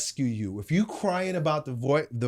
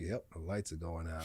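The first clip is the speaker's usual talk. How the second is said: much lower and softer, deadpan; dry low aside; 11 semitones lower, 18 dB quieter than usual. Retold or imitated. Father calmly noting his son is about to pass out, deadpan. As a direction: mutter it like a calm coach who's seen it before. Dad dryly watching son collapse.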